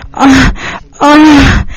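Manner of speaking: voiced acted moaning. A narrator's reading.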